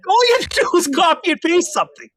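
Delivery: very high, loud incredulous squawk; high-pitched teasing outrage; mock exasperated scolding. Real, not acted. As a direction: scold in playful disbelief, voice shooting right up. Mock scolding of trainee being teased.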